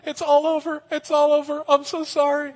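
high, whimpering mock panic; hammed-up high terrified pleading; mock freaking out. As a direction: whine it like a terrified coward. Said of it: "freaking out".

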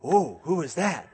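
booming megaphone-style "Mary", mock startled reply. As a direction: call the name through a megaphone. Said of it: "megaphone voice".

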